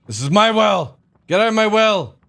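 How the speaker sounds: loud high territorial yell; angry shout. Acted. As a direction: chase him off your turf. Defending territory in game.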